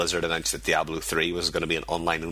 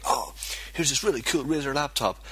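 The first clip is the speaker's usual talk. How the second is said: higher-pitched imitation of gushing fans; mock awe; 8 semitones higher than usual. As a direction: gush over it like an impressed crowd. Mimics everybody's excitement over laptop.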